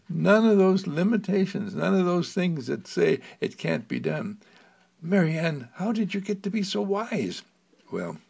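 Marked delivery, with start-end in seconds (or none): 5.0-7.4 put-on lightly raised child's voice, curious; boy puppet voice. Little Jerome asking Mary Ann, in a higher child's voice. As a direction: ask her brightly like a small boy.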